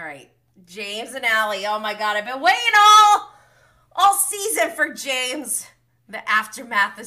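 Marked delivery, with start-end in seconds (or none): 1.6-5.6 high, very loud fan excitement; excited gushing. Real, not acted. Fan finally gets to James's scene, excited gushing. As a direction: build to a loud, high, thrilled cry.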